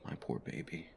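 soft pitying whisper. Acted. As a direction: whisper it tenderly. Comforting partner home from doctor.

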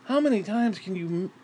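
usual speaking voice

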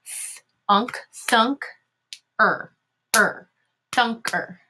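Th-, unk, thunk, er, thunker, with gaps between parts; teacher blending thunker from its sounds; sounding out. Shown as a demonstration. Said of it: "Here's our first syllable".

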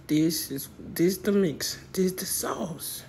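hushed, slow whisper; low, slow conspiratorial whisper; secret whisper. Acted. Revealing secret mix.

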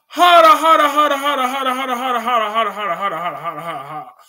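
fading repeated protest. Real, not acted. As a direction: start big and let it fade out.

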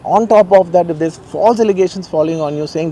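ordinary talk, nothing marked

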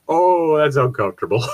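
usual speaking voice

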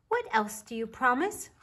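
put-on high, small child's voice; little bear voice. A narrator's reading. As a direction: voice a curious little cub.

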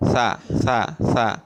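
সা said thin and light, tongue tip on the upper teeth; a light, crisp সা with no heaviness. Shown as a demonstration. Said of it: "সা দাবর পাতলা করে". (পাতলা করে সা)

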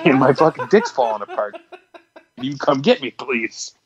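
words breaking into laughter; laughing through it. Retold or imitated. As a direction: act out the phone plea while cracking up. Laughing through imagined call to parents.

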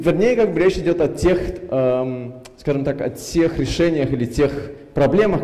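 ordinary talk

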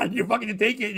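usual speaking voice

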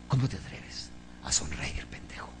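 hushed, mocking whisper. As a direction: hiss it like a scolding inner voice. Mocking a gloomy inner voice.

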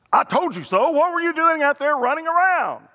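loud, high-pitched telling-off; mock scolding. What the father doesn't say to the returning son, mock scolding. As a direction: lay into the wayward child with exasperation.

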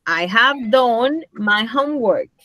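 slow, spaced-out English example; deliberate teacher demonstration at a slow pace; slow model sentence. Shown as a demonstration. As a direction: give the class each word slowly.

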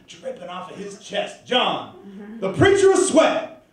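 dramatic poem performance, surging and falling back; swelling from hushed lines to ringing cries; spoken-word storytelling. Acted. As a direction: perform it as a railroad worker's tale.

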